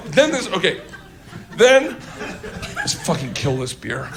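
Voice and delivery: normal talking voice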